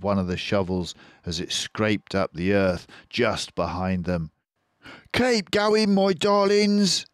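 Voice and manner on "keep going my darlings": high fox voice, urging and breathless; Mister Fox panting. A narrator's reading. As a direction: push the family on, high and slower on darlings. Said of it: "panted Mister Fox".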